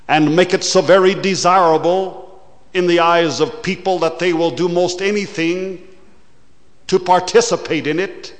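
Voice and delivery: preaching at full force. Acted. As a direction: paint the glitter with fervour. Preacher mocks the glitter of sin.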